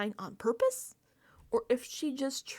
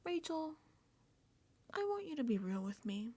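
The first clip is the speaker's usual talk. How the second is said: soft, higher pleading Mickey voice; mock-earnest softer plea; 6 semitones higher, 7 dB quieter than usual. Mocking Mickey begging Rachel, soft pleading voice. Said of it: "She's like".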